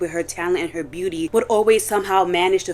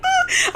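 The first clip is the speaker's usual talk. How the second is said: laugh. Real, not acted. Laughs remembering scary video.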